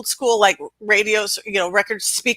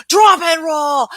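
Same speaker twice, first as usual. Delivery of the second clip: loud, slow, higher yell; shouted command; 6 semitones higher, 6 dB louder, slower than usual. Retold or imitated. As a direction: bark the order at a man on fire. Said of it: "I'm screaming".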